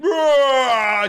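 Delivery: loud, high, drawn-out imitated roar; tiger roar. Telling of the tiger video, imitated tiger roar. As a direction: roar like a tiger bursting from nowhere. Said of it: "that fucking tiger fucking".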